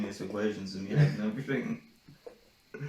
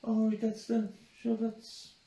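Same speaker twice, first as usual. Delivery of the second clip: mock sweet girl; 9 semitones higher, slower than usual. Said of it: "she's like".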